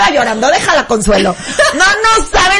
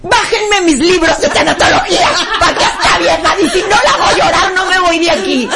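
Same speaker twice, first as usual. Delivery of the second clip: laughing, raised comic outburst; mock indignation; 3 semitones higher than usual. Host answering the stranger with her expertise, mock indignation. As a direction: command it through laughter, hammed up.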